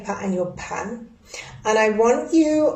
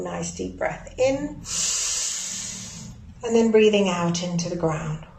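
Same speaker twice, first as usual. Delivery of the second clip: guided breathing; 3 semitones lower, 3 dB quieter than usual. Acted.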